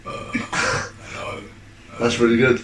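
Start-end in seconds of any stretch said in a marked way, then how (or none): 0.1-1.4 Reese impression. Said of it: "we'll do a Reese impression".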